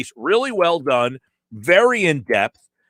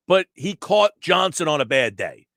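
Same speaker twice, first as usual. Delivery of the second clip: ordinary delivery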